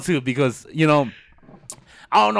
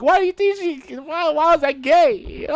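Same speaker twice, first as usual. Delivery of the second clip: mock whine; 11 semitones higher, 3 dB louder than usual. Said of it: "I was like".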